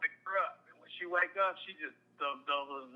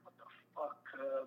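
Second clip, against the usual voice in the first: stunned whisper; 3 semitones lower, 10 dB quieter than usual. Retold or imitated. Reading letter about grandma's death.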